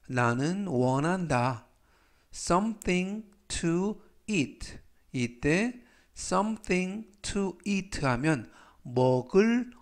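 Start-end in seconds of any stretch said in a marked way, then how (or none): 2.3-4.8 very slow, each word clear; slow model reading. Shown as a demonstration. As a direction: read it slowly for learners. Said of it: "활용 문장 보시겠습니다". (천천히 또박또박 읽는 예문)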